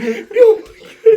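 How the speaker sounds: high burst of laughter; loud laugh. Real, not acted. Teller laughing at his friend sprinting after the skunk.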